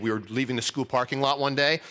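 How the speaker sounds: ordinary talk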